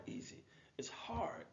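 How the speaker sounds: dramatic whisper. Acted. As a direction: drop to a hushed whisper for emphasis. Stressing that change is hard.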